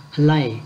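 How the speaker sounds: halai said clearly, ha-lai, falling at the end; model pronunciation. Shown as a demonstration. Teacher modelling halai for the class, clear and falling. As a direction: say halai slowly and cleanly, falling. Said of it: "Đọc từ này là halai".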